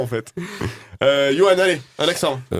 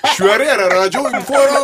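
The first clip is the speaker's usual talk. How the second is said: a louder, higher mock Belgian accent with a laugh; imitating the Belgian accent; 8 semitones higher, 8 dB louder than usual. Host parroting the guest's Belgian accent while laughing. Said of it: "depuis quand les Belges roulent les R".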